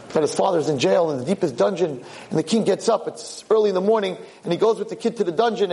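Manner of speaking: ordinary delivery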